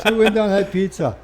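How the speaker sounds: loud, high-pitched telling through laughter; laughing. Real, not acted. Man telling interviewer about the pizza outing, laughing. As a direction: carry on the story still laughing.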